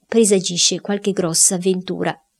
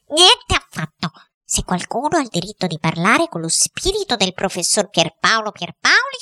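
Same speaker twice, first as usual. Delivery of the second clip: indignant, imperious wife in a raised character voice; brusque interruption. Acted. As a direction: cut him off haughtily, pitch leaping on the key words. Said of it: "lo interruppe bruscamente". (interruzione brusca, moglie prepotente)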